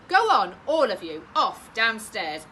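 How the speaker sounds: loud, firm mum; shooing. Acted. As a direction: shoo the kids away. Mum shoos children out.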